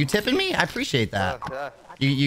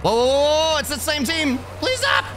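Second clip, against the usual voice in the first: panicked yelling; 14 semitones higher, slower than usual. Acted. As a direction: yelp as bullets hit your car. Same team shooting his car.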